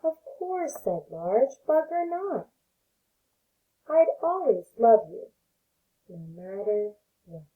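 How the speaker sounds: gentle read-aloud voice for little ones; storybook reading. Acted. Teacher reading a picture book to her pre-K class, gentle storytelling.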